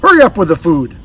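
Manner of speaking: impatient loud holler. Acted. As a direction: holler across the house for the food. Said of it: "she hollered to Zach".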